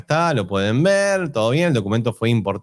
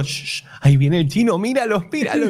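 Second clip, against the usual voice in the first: whispering while hiding; 6 semitones higher than usual. Retold or imitated. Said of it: "el padre ... escondido con el chico".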